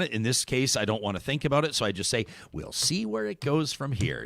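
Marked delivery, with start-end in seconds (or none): 2.5-4.3 soft, neutral anchor sign-off; news anchor voice. Shown as a demonstration. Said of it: "six o'clock news anchor impression".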